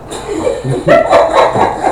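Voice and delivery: high, real laughter; amused laugh. Real, not acted. Cracking up after saying he's just being honest, amused laugh. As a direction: break up laughing, high-pitched.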